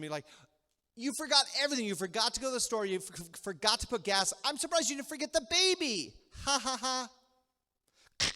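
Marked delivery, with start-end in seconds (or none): 0.9-6.1 raised, high-pitched mock tirade at a husband; lashing out. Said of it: "lashes out at the husband". 6.4-8.3 high-pitched forced ha-ha-ha; fake laugh. Fake laugh after mean joke.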